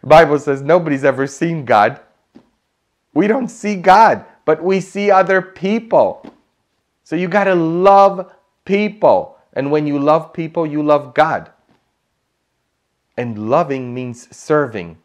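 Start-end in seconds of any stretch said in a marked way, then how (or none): 0.0-11.5 high, raised preacher's voice; impassioned sermon delivery; forceful preaching. Real, not acted. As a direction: drive the challenge home to the congregation. Preaches at raised pitch and volume.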